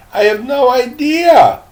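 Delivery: put-on high boyish voice for the young lad; boy's voice. Teacher voicing young Trey, high and boyish. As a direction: pitch it up like a puzzled boy. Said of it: "I gotta get the voices right".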